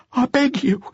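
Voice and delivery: high, frightened plea; desperate begging. Acted. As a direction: beg as if broken. Broken youth begging.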